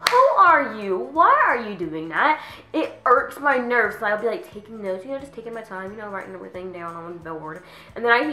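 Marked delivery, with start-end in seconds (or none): 0.1-2.4 loud, high, drawn-out challenge; mock outrage. To the classmate slamming a pencil, loudly indignant. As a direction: confront them in exaggerated disbelief.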